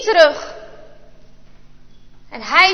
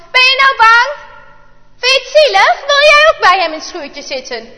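higher, louder mocking jeer; taunting; 7 semitones higher, 6 dB louder than usual. A narrator's reading. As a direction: sneer at the scared friend. Boys taunting Bart. (spottend en luid uitlachen)

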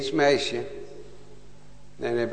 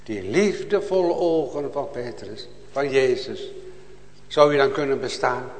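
ordinary talk

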